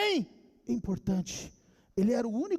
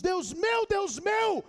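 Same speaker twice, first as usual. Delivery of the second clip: high, drawn-out preacher's cry; raised, pleading pulpit wail; Jesus crying out; 9 semitones higher, much slower than usual. Acted. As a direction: cry out slowly in abandonment. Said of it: "Quando Jesus gritou na cruz".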